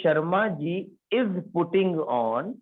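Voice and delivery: each word drawn out slowly for the class; slow dictation. Shown as a demonstration. Teacher dictates the sentence word by word. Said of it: "तो उसने कहा".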